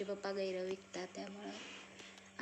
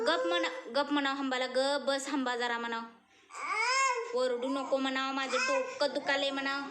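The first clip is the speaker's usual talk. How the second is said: high, loud and teasing with a laugh; 9 semitones higher, 7 dB louder than usual. Real, not acted. Mother playfully tells baby. (हसत हसत लाडिक उंच आवाजात)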